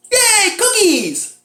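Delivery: very high, slow, loud cheer; mock gleeful husband. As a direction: stretch it into an exaggerated squeal of joy. Imitating husband cheering the cookies.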